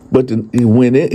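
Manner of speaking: ordinary talk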